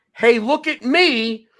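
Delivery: mocking show-off. Mocking attention-seeking pitch invaders.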